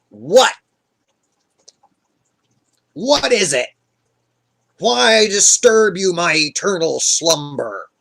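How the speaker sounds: loud, irritated demand; annoyed dead prophet. Retold or imitated. Samuel disturbed from eternal slumber, annoyed. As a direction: snap as if woken against your will. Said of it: "he's not happy".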